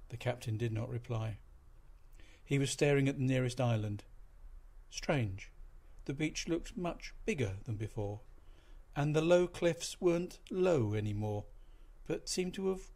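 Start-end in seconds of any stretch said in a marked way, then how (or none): none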